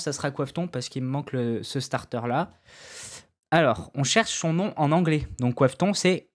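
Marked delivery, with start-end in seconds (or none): none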